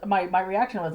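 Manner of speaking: ordinary talk, nothing marked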